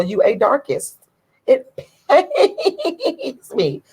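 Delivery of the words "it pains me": laughing through the words, pitch jumping up; half-laughing mock pain. Real, not acted. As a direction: giggle out the pain. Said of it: "I think I'm going to cry".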